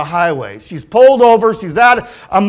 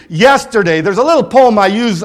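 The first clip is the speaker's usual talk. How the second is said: ordinary talk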